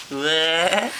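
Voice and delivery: ordinary talk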